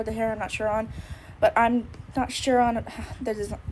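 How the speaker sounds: usual speaking voice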